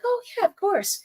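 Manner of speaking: gladly agreeing to give mom a massage, high and eager; happy yes. Retold or imitated. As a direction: chirp it like you're glad to help. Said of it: "I'd be like".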